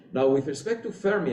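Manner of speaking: ordinary talk, nothing marked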